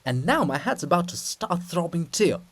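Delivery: exasperated, put-upon princess; a royal lady fed up with the noise; princess's weary complaint. Acted. Princess complaining to Cyril about the noise, exasperated.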